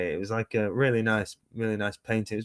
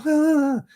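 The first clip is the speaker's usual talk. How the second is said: startled yelp; 8 dB louder than usual. Retold or imitated. Reacting after being paid.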